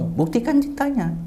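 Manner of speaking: high-pitched insistent challenge. Retold or imitated. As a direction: re-enact demanding proof through tears. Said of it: "Suara agak tinggi sambil nangis saya". (menuntut dengan suara tinggi)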